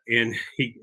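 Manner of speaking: normal talking voice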